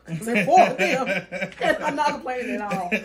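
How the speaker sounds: loud laugh bursting through the words. Real, not acted. Aunt laughing to friends about suddenly raising five kids, loud laughter.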